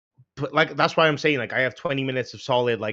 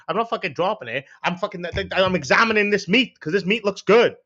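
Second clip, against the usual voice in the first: acted indignant protest; 5 semitones higher, 4 dB louder than usual. Acts out snapping at onlooker.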